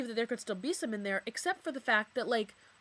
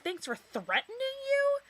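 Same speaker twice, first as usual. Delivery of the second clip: high, slow, incredulous retort; stunned echo of his message; outraged disbelief. Real, not acted. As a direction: repeat it as if it's absurd. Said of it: "Like, what the fuck?".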